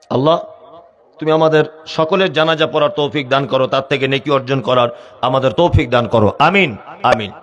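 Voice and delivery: low, subdued supplication; hushed-down, earnest congregational prayer. Real, not acted. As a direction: drop low and pray for everyone. Leads a short prayer.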